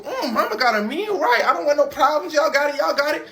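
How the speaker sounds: high, loud hammed-up surrender; mock backing down. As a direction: plead your way out after the punch. Knocked-down Lindsay backing off.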